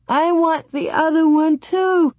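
very high acted child's wail; loud sobbing. A narrator's reading. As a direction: wail it like a tearful little girl. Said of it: "Laura sobbed loudly".

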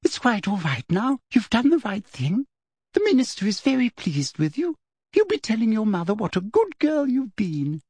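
high, sweet, falsely kind voice; sugary Umbridge. A narrator's reading. Umbridge praising the informant to Fudge, high and sugary. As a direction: purr the praise like a sickly aunt.

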